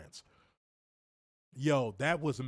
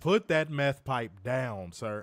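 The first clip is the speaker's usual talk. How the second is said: slow mock scolding; much slower than usual.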